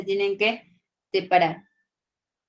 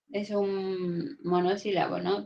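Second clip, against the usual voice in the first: ordinary talk, nothing marked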